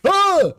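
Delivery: short, high, loud "Ah!" scare; sudden shriek to spook the listeners; jump-scare yell, very high and loud; playful loud scream. Pretend. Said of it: "sorry if that was a little too loud".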